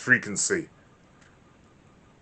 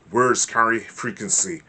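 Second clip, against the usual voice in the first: normal talking voice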